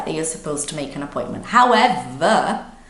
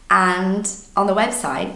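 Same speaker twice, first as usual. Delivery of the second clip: ordinary delivery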